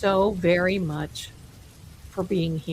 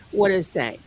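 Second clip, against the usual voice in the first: ordinary talk, nothing marked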